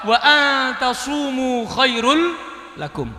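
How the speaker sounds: slow, measured recitation of a verse; slow Quran recitation. Acted. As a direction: chant the verse slowly. Woman answers in Quran verse.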